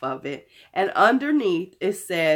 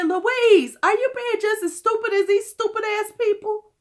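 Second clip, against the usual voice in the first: high-pitched mock self-scolding; scolding herself; 12 semitones higher, 3 dB louder than usual. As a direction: scold yourself in a squeaky voice. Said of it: "And then I said".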